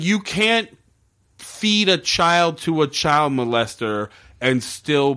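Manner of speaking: high, incredulous protest, slowing down; appalled protest. Retold or imitated. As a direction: plead against it, dragging the words out. Viewer's appalled plea to character.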